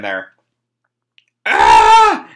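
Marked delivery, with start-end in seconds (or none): 1.4-2.3 loud, high scream; acted scream. Boy tripped by a dead butler, acted scream. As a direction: scream out in terror. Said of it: "he screams and runs off".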